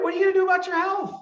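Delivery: high, fast nagging voice, hammed-up; mock nagging voice. Coach nagging listeners about their health, high mock nag. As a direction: pester them like a nagging parent.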